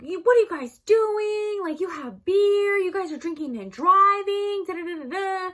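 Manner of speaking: high, loud scolding mother; angry mom yelling. Retold or imitated. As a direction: scold the kids for drinking and driving.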